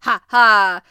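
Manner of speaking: big, booming two-beat stage laugh; hungry harpooner's very loud mock laugh; loud acted "ha, ha". A narrator's reading. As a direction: laugh it out deliberately, full volume. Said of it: "said Ned Land, moving his jaws".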